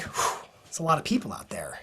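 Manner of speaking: a hushed, nervous retold whisper. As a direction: breathe it out under your breath, nervously. Nervous speaker backstage before crowd.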